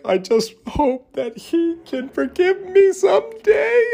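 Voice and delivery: very high, drawn-out fake sobbing; hammed-up tearful squeal, mimicking her; mock crying. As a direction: wail fake crocodile tears in falsetto. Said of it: "she cries and she's like".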